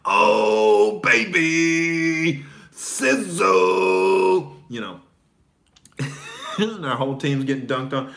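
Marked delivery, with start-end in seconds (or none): 0.0-4.6 loud, high mock taunt; trash-talking heckler. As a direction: crow it at the beaten player. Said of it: "he's saying".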